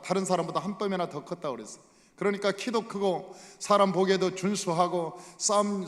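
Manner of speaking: ordinary talk, nothing marked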